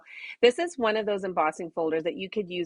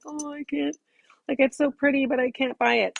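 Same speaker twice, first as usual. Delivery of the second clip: wistful viewer; 7 semitones higher, 3 dB louder than usual. Retold or imitated. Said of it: "being like".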